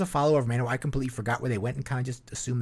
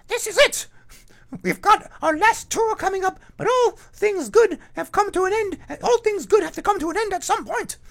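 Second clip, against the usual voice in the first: Eric Adams impression; 7 dB louder than usual. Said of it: "I'll talk like Eric Adams".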